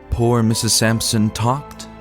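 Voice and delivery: ordinary delivery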